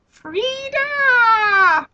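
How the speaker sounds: high squeaky gnome call. Acted. Gnome calling out to Frida, high and squeaky. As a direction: call her name like a tiny gnome. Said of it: "he said".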